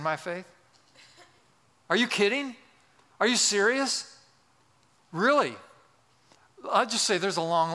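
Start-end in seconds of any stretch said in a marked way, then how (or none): 1.9-5.5 high, rising disbelieving questions; mock incredulous. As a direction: ask as if it were absurd, pitch jumping up.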